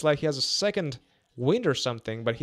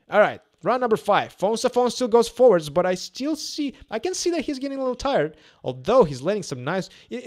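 play-by-play; 3 semitones higher, 5 dB louder than usual. Real, not acted. Live boxing commentary.

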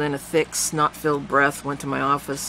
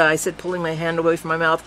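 ordinary delivery

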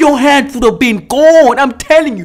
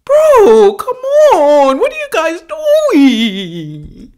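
exasperated plea; 6 semitones higher, much slower than usual. Real, not acted. Viewer pleading with anglers near alligators, exasperated wail.

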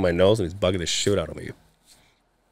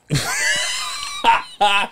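shrieking high laugh. Real, not acted. Bursts out laughing.

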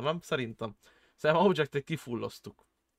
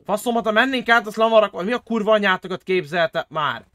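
loud, raised exasperated swearing; angry ranting; 5 semitones higher, 7 dB louder than usual. Real, not acted. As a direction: rant furiously about the blocked road. Ranting about the blocked border.

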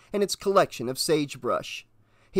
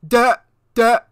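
stupefied stammer; 8 semitones higher, 6 dB louder, slower than usual. A narrator's reading. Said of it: "Martell informed him stupidly".

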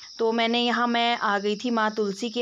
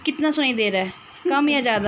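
loud and high so a deaf ear catches it; raised voice; 3 semitones higher, 3 dB louder, faster than usual. Real, not acted. To the hard-of-hearing uncle, loud and high. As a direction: call out clearly to someone hard of hearing. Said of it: "ज़ोर ज़ोर से चिल्ला चिल्ला के बोलना पड़ता है".

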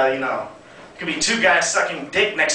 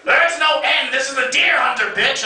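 high, barking mock yell at her date; barked order; 8 semitones higher than usual. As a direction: bark it like a furious drill sergeant. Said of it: "she looked down and barked".

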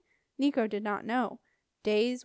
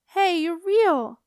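loud, high and slow with disbelief; overjoyed; 7 semitones higher, 10 dB louder, much slower than usual. Acted. Septimus hugging his lost brother, slow joyful shout. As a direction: savour the wonder that he is really here.

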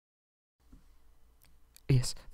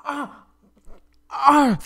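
pained cry; 5 dB louder than usual. Acted. Prince in pain.

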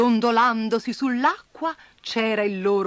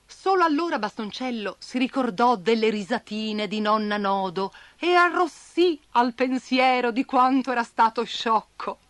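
plain reading voice

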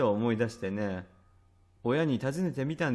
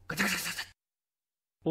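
quick rattling doorknob noise, higher; fast doorknob rattling; 4 semitones higher, 3 dB quieter, faster than usual. Retold or imitated. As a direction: rattle it out faster like a jiggling doorknob. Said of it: "音が聞こえてくるんだ".

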